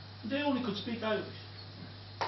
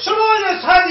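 shouted, higher and louder; retold shout; 8 semitones higher, 10 dB louder than usual. Heckler mocks Irish history, shouted high and loud. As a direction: yell it scornfully from the crowd. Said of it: "the German shouted up to me".

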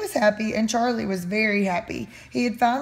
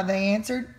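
ordinary delivery, nothing marked

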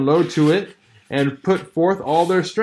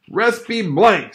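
higher, slow angry oath; slow curse; 7 semitones higher, 3 dB louder, slower than usual. Acted. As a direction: spit out the curse. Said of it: "said Hal with his beardless lips".

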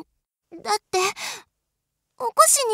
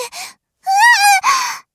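startled cry; 11 semitones higher, 12 dB louder, much faster than usual. Real, not acted. Suddenly pulled into a hug.

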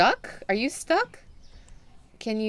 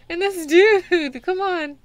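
exasperated groan; 8 semitones higher, 8 dB louder, slower than usual. Real, not acted. Exasperated at blocking customer.

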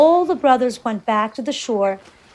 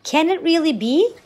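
higher, disbelieving cry; 6 semitones higher, 3 dB louder than usual. A narrator's reading. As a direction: cry out in dismay, pitched up. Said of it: "cried the brother on the raft".

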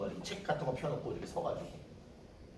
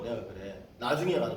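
ordinary delivery

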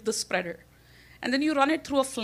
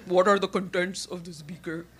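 stiff official voice; 4 semitones lower than usual. Retold or imitated. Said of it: "this unnecessarily formal terminology".

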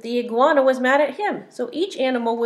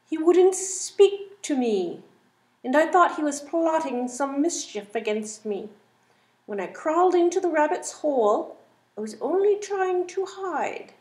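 python voice; 6 semitones higher than usual. Acted. Python excuses himself, voiced.

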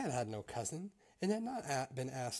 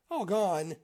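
higher, much louder exclamation; shocked cry; 7 semitones higher, 11 dB louder than usual. A narrator's reading. Mouse repeating the third child's name, shocked cry. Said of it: "cried the mouse".

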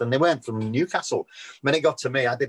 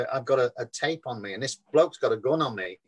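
usual speaking voice

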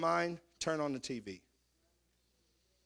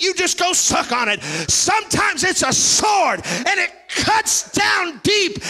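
preaching at full force, higher and louder, slowing on the sword; 8 semitones higher, 9 dB louder, slower than usual. Acted. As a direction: pound every word like a revival preacher.

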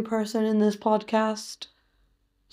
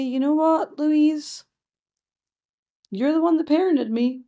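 high, louder playful comeback; mock retort; 7 semitones higher, 4 dB louder than usual. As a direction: talk back to mum in mock indignation. Mock retort to her mum.

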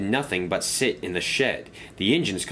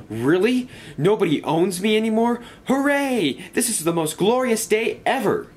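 much higher, louder overjoyed cheer; very excited; 14 semitones higher, 4 dB louder than usual. Acted. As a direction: burst out with joy. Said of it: "Spencer suddenly became very excited".

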